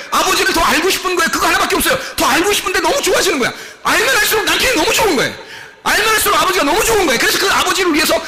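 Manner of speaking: high, loud impassioned preaching; fervent preaching. Acted. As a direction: preach it out with rising fervour. Preaching at full force.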